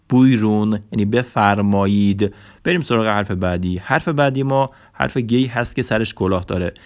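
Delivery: ordinary talk, nothing marked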